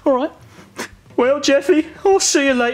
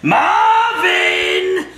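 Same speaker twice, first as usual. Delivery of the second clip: menacing horror shout; 7 semitones higher, much slower than usual. Acted. Frog breaking in, horror parody.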